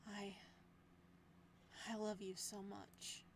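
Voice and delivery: soft, slow declaration of love. Acted. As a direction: say it tenderly, almost quietly. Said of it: "genuinely soft tone".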